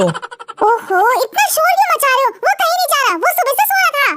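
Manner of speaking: a fed-up mom defending her son; mother figure, annoyed. Acted. Mother figure voiced by narrator. (झुंझलाई हुई माँ की ऊँची आवाज़)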